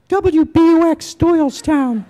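put-on very high, loud, drawn-out boy's radio ID; mock station announcement in a piping adolescent squeak; squeaky fifteen-year-old DJ. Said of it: "in my massive fifteen-year-old voice".

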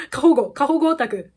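laughing at herself. Real, not acted. Fan laughs at her own overprotectiveness. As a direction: giggle through the words.

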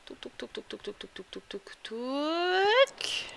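soft ticking tocs, last one gliding upward; little toc-toc sound effect ending in a rising, drawn-out toot; playful "touc" noises. Pretend. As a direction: tick along quietly, then swoop up on the final touc. Idle noises while moving in game.

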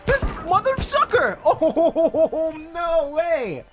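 loud high shriek with laughter; shocked yelp. Real, not acted. Gamer shrieking as an enemy jumps him. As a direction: screech in laughing disbelief.

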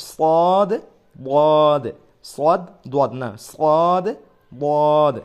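loud, long heavy সদ and বদ; heavy Arabic letter. Shown as a demonstration. Teacher sounding heavy letters for class. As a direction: drag out সদ thick and loud. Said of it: "ল একটু মোটা".

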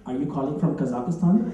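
low, measured voice-recognition robot; automated phone system. Acted. Phone system greets a caller, automated voice. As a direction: recite the menu as a low automated voice. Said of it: "an interactive voice recognition system".

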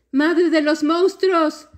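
very high, loud, drawn-out call; little girl calling. A narrator's reading. Smallest girl addressing the monster in the river, calling out. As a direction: call out slowly in a small child's voice. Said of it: "dijo la niña más pequeña".

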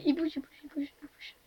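hushed whisper while hiding. Real, not acted. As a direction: whisper so the seeker can't hear. Hiding as the seeker moves.

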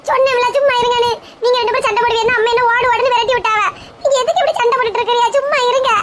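high-pitched, distressed protest; upset pleading. Acted. Youngster begging quarrelling aunt and uncle, upset pleading. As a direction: plead in a high voice for the fight to stop.

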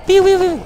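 high squeaky piu piu; cartoon zap sounds; shooting sound effect. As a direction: make toy blaster noises. Shooting poison at zombies.

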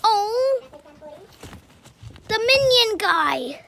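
loud, slow goofy call-out; goofy announcer. Acted. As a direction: ham up the line, slow and loud. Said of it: "And we gotta say".

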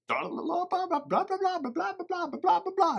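put-on very high, sing-song kid babble; mock the chattering little boy; imitating son's chatter. Dad mimics son interrupting his video in a high babble. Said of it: "He's like, Zane Chapin".

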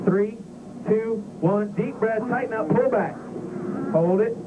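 high, driving callouts, breathe counts; urgent breathing coach. Real, not acted. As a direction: push the pilot through every breath. Instructor calls breathing during the G run.

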